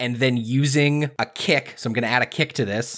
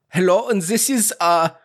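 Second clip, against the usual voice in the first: high-pitched German professor voice; bad German accent; 8 semitones higher than usual. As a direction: put on a hammy, higher German accent. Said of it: "a German null accent".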